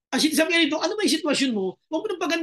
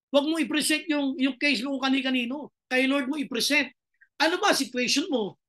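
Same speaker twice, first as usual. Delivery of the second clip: usual speaking voice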